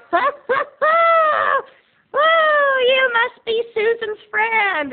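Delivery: loud, slow, very high screech; hammed-up dazed old lady, shrieking her greeting; high-as-a-kite grandma. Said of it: "high as a kite in her satin pajamas".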